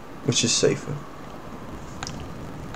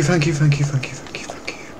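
fading thanks; 2 semitones higher, 8 dB quieter than usual. Real, not acted. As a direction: thank you repeated, fading to a whisper. Relieved gratitude fading out.

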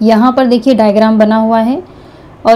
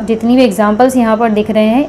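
usual speaking voice

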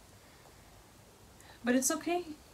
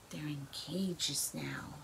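whisper; 4 semitones lower, 8 dB quieter, slower than usual. Real, not acted. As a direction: lean in and whisper it slowly. Confiding to child during story.

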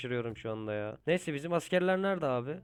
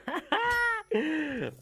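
high gleeful laugh; 3 dB louder than usual. Real, not acted. Laughs after blowing through enemy.

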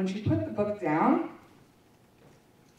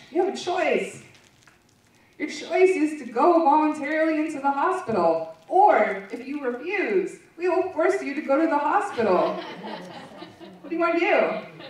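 higher, louder mock official voice, sarcastic; a louder, higher sarcastic take on the staff; 8 semitones higher, 6 dB louder than usual. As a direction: parody the hospital staff's fake choice. Mocking the staff's forced-choice ultimatum.

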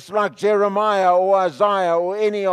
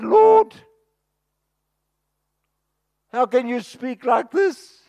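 anguished protest; 6 semitones higher, 3 dB louder than usual. Acted. Prophet protesting to God.

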